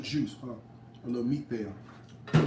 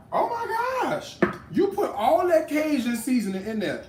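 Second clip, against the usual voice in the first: mock a horrified commenter in a shrill falsetto; mock shocked viewer; 11 semitones higher, 4 dB louder than usual. Cook mocking a viewer's reaction, high mock-shocked squeal. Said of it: "I know what you're gonna say".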